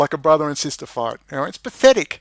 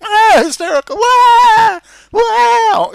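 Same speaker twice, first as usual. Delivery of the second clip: much higher, much louder mock-hysterical wow; mock hysterical shout; 11 dB louder, much slower than usual. Real, not acted. Mocking the complainant's email, mock hysterical shout. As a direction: shriek in mock disbelief.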